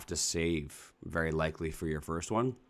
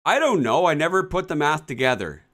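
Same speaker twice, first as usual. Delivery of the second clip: much higher, loud, squealing rant; upset ranting; 10 semitones higher, 7 dB louder than usual. Real, not acted. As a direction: rant in shocked outrage. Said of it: "Whoa, easy".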